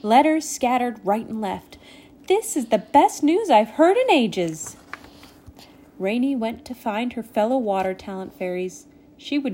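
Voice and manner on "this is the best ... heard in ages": thrilled, high and loud. A narrator's reading. Spring hears of the frost party, thrilled. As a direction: burst out with delight at great news.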